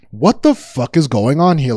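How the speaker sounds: ordinary talk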